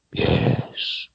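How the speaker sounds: low, soft, breathed wizard voice; hushed confirmation. A narrator's reading. Wizard confirming to the girl, hushed. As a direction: breathe it out softly.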